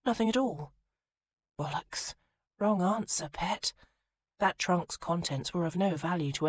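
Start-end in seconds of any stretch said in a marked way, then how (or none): 0.0-0.7 hasty denial. Acted. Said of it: "Minerva jumped to answer". 1.6-3.7 highwayman. Acted. Highwayman mocks Minerva.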